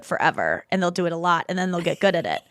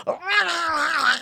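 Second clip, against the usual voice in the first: playful high growling dog noises; 8 semitones higher, slower than usual. Retold or imitated. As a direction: play the dog snarling at the sitter. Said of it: "And he's like".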